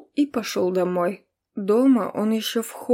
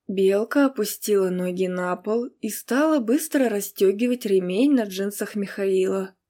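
ordinary delivery, nothing marked

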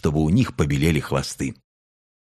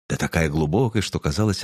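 ordinary delivery

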